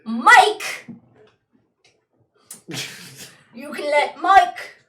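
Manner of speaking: loud, high, hammed-up pirate; big shouted sea-dog send-off; pirate sea captain. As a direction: holler like a captain on deck. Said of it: "only way I know how to play sea people".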